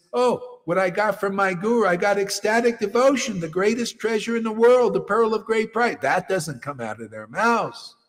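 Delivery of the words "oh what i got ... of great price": mock ecstatic. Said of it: "never comes out of their mouth".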